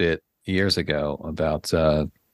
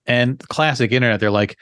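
usual speaking voice

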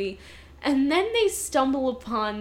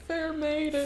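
prince impression; 4 semitones higher, 5 dB quieter than usual. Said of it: "you do a really good impression".

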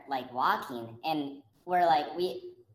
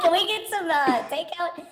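hammed-up high call asking for takeout; mock calling out; 10 semitones higher than usual. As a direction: call out like a lost customer. Calling out in abandoned restaurant.

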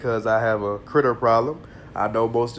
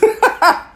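short high-pitched laugh; 8 dB louder than usual. Real, not acted. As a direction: burst out laughing. Laughs at snake.

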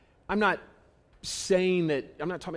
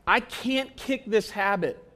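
ordinary delivery

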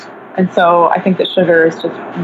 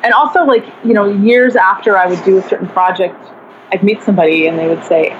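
ordinary talk

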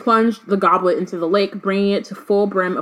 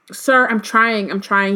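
ordinary delivery

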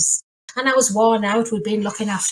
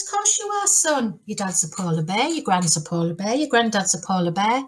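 reassuring mum. Acted. Mum answering her son, reassuring sing-song. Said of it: "And she says".

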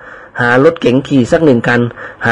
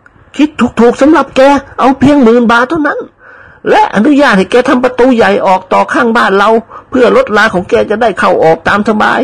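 put-on old lady persuading; 9 semitones higher than usual. Old lady urges servant to settle near her, high coaxing voice. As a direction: coax him to live nearby.

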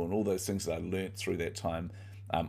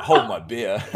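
mock bravado said through a laugh, louder; laughing quote; 2 semitones higher, 9 dB louder, slower than usual. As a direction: toss off the dare while laughing. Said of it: "It's like".

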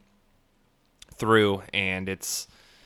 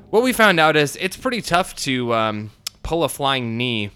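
normal talking voice

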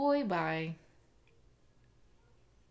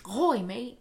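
mock Australian greeting, louder and higher; playful Australian "ahoy"; Australian accent; 4 semitones higher, 6 dB louder, slower than usual. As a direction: hail a mate in a put-on Australian accent. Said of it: "That's Australian".

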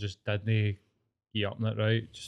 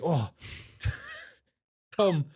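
laughing mock uncle; 6 semitones higher than usual. Uncle Mac quoted at funeral.

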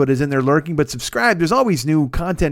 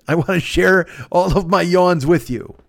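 usual speaking voice

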